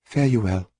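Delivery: stammered farewell. A narrator's reading. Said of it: "she stammered".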